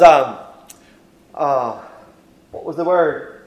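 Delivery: normal talking voice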